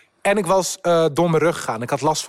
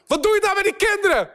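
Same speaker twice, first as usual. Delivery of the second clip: hoge, harde beschuldiging; schreeuw. Retold or imitated. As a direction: roep hem woedend toe. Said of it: "Ik zo". (boos roepen naar de vreemde man)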